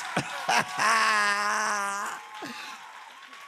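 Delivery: high, loud laughing whoop; shouted laugh. Real, not acted. Pastor whooping with laughter over the testimony. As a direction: let out a whooping laugh of joy.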